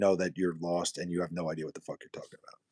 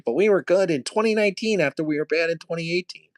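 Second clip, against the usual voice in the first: higher mock voice of a defensive fan; mocking a viewer; 7 semitones higher, 3 dB louder than usual. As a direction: parody his excuse, a little whiny. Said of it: "started telling me".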